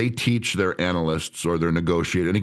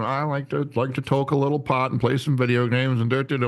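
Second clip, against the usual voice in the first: higher, casual imitation of an employee; Ricky chatting; 7 semitones higher than usual. Employee telling the trainer his hobbies, casual put-on voice. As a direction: chat idly in a pitched-up put-on voice. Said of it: "I was like, "So, Ricky, where are you from?".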